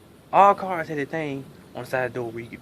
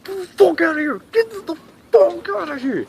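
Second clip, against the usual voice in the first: high screaming plea; panicked shout; 12 semitones higher, 5 dB louder than usual. Retold or imitated. Trapped in the car, screaming for help.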